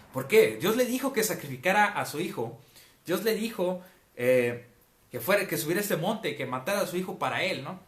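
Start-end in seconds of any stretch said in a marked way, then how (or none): none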